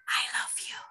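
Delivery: soft clear whisper. Shown as a demonstration. Teacher demonstrating a whisper to kids, clear whisper. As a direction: whisper it but keep it clear. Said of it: "Kak [name] mau bisik kayak gini".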